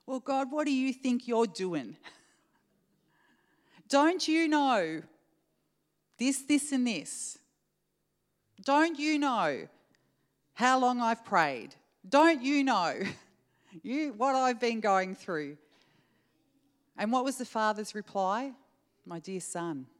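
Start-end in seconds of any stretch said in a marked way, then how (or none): 0.1-15.6 high, loud indignant whine at God; petulant protest, pitched up and pushed; whiny complaining to God. Retold or imitated. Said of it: "I actually do sound like that". 19.0-19.9 tender father. Acted.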